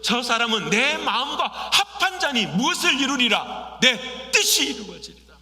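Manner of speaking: loud, driving sermon voice; fervent preaching. Acted. Pastor urging the congregation on prayer, fervent preaching.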